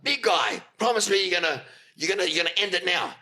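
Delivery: high-pitched pressing boss impression; imitated stern demand. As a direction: act out a boss demanding a promise. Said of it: "he's pointing at me".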